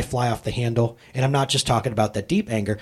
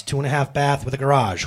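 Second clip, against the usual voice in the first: normal talking voice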